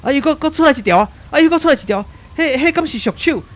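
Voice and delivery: a newsman losing his nerve on air; terrified reporter. Acted. Reporter Carlo seeing tentacles emerge live, terrified and loud. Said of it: "咱继续转来甲卡洛这边".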